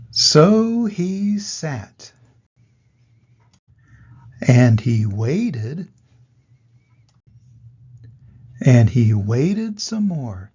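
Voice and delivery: drawn out, heavy and loud; slow dramatic pause. A narrator's reading. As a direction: stretch each word to show waiting. Dramatic slow waiting in story.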